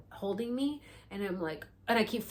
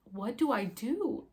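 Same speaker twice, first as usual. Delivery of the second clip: lost panic; 9 semitones higher, 4 dB louder than usual. Retold or imitated. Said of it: "I just felt so lost".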